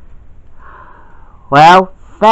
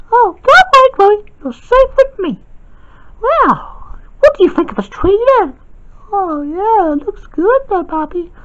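put-on a child's toy voice, much higher; high girl voice; 12 semitones higher, 3 dB quieter than usual. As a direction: lift into a high little-girl voice, chatty. Girl figure chatting by tree.

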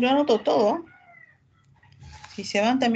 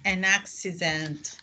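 an accident said slowly and clearly; slow model reading; 4 semitones lower, 5 dB quieter, slower than usual. Shown as a demonstration. Teacher modelling the answer for Christopher, slow and clear. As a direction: spell out an accident slowly for the class.

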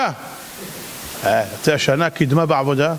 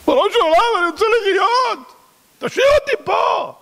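imitated frantic prayer, pitched up; desperate plea. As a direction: beg for your life. Pleading at closing prayer.

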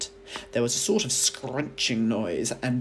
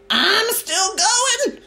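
much higher, louder, slow screeching crone; old screechy voice; 10 dB louder, much slower than usual. A narrator's reading. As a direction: crow it down from the attic like a witch. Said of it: "came the old screechy voice from up above".

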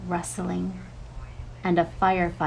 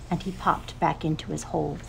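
ordinary delivery, nothing marked

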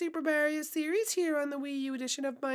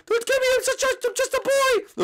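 higher, mock-terrified wail; panicked begging; 5 semitones higher, 4 dB louder than usual. Fan begging Peach not to kill, panicked wail. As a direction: plead desperately, voice jumping up high. Fan begs for his life.

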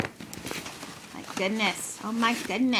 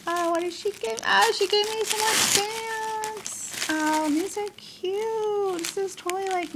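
delighted; 8 semitones higher than usual. Real, not acted. She gave me some more stamps, delighted. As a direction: coo with delight, stretching the words.

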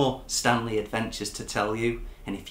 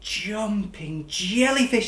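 slow, mildly higher delighted squeal; 10 semitones higher, much slower than usual. Acted. Stanley seeing his shoal, mild delighted squeal. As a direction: squeal it with joy. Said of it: "he squealed".

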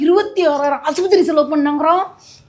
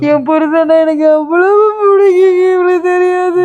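louder, crying wail over the husband; loud hammed-up crying; 4 semitones higher, 7 dB louder than usual. Wife loudly cries to the visitor over her missing husband. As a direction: sob it out big and theatrical.

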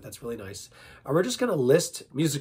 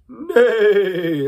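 high, loud neigh with vibrato; whinny loudly, voice quivering; quivering horse neigh; 7 semitones higher, 12 dB louder than usual. Shown as a demonstration. Practicing vibrato with animal sounds, horse neigh. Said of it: "That's like practicing vibrato with your voice".

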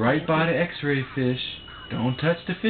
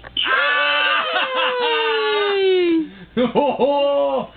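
loud high squealing laugh; delighted laugh; 4 dB louder than usual. Real, not acted. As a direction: burst out laughing with joy.

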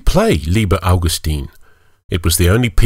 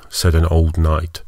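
plain reading voice

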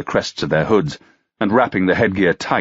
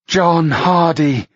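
moaned; 10 semitones higher, 3 dB louder, much slower than usual. Acted. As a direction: moan the name out long and high. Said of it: "she moaned".

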